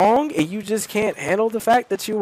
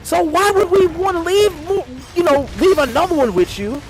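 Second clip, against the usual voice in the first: mocking high voice; 10 semitones higher than usual. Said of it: "she's like".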